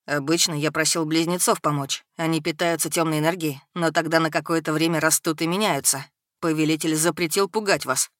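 put-on resigned young dark creature; troubled servant boy. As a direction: explain it soberly, pitched a little down.

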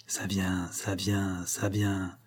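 jealous heart hushed, gloating; gloating inner voice. A narrator's reading. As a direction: savour it in secret, hushed. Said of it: "criait son cœur envieux".

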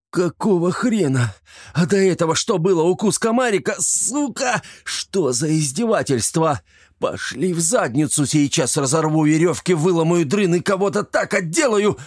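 high, furious yelling in pain; fit of rage. Acted. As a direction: lash out in a screaming fury. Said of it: "меня настиг приступ ярости". (яростный крик от боли)

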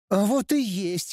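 high taunting singsong; teasing. Acted. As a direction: tease like a smug child. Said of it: "дразнился женский голос".

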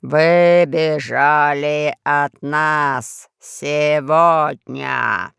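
низкий хриплый, очень медленно; медленно, по слогам, хрипло; low hoarse voice. A narrator's reading. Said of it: "рычал в микрофон Пашка". (низким хриплым голосом, по слогам)

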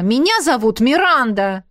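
cross, insistent correction; angry. Acted. Trainee correcting coach on her name, angry. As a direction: insist hotly on your own name. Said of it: "рассердилась она".

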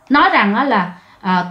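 ordinary delivery

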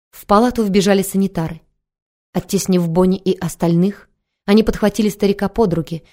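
ordinary delivery, nothing marked

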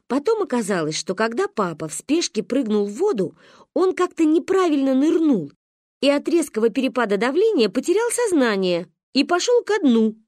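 ordinary delivery

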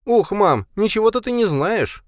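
mouth full. A narrator's reading. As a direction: huff it through a mouthful of food. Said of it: "возмутился Джейсон с набитым ртом".

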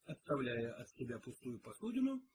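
ordinary delivery, nothing marked

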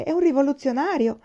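anxious protest. A narrator's reading. Jailer warning Aiwan the student is a revolutionary, alarmed. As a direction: blurt it out in alarm. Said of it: "disse il carceriere".

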